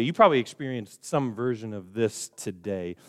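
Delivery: ordinary delivery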